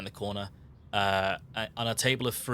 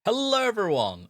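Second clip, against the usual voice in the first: upbeat host greeting; 9 semitones higher, 5 dB louder than usual. Real, not acted. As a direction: sing out the hello to the audience. Host greeting opens the show.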